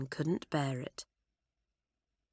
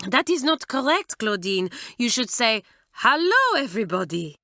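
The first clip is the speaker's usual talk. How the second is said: excited correction; 5 semitones higher, 3 dB louder than usual. A narrator's reading. Mamselle correcting Claudine.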